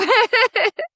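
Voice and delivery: high, loud self-conscious laugh; laughing off the emotion; emotional laugh. Real, not acted. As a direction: burst into high giggles at oneself.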